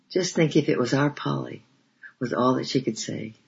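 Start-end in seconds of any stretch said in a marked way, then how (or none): none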